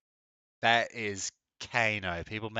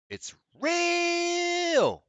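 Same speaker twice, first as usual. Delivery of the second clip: excited shout; 4 dB louder than usual. Real, not acted. Excited at spotting the cog.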